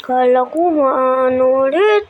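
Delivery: ordinary talk, nothing marked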